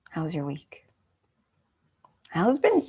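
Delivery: ordinary talk, nothing marked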